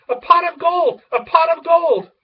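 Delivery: high, loud excited cry. Acted. Pig hears of gold and cries out, high and loud. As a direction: burst out in greedy excitement.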